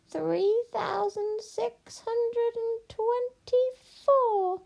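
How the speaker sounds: very high, very slow witch chant; witch counting. Acted. Witch ignores princess to count gold, droning. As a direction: intone the count in a long high drawl.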